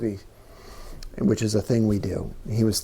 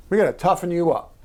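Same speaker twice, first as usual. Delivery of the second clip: mild imitation of a stern father, higher and a touch louder; harsh father; 6 semitones higher, 4 dB louder, faster than usual. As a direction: bark it like a tough father, lightly. Father's words retold, harsher take.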